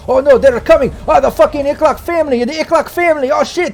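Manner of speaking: high, panicked yell of alarm; frantic, high-pitched shouted warning; Mister K yelling out. Acted. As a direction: cry out loudly in a high-pitched character voice. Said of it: "like, yelling out in his language".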